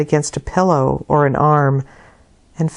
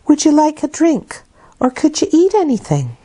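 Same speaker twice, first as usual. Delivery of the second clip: much higher maid's voice offering kindly; a nurse offering a drink to a sick girl; Bessie's voice; 11 semitones higher than usual. Acted. Bessie offers food to Jane.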